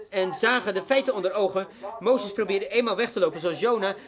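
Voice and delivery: ordinary talk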